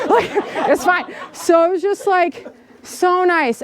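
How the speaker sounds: giggly and pleased, stretching the last words slowly; laughing. Real, not acted. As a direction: laugh through it, savoring the memory. Laughing while recalling wilderness joy.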